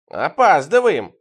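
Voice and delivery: loud, drawn-out taunt; teasing jab. A narrator's reading. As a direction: needle her loudly, stretching the word. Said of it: "подъебнул её я".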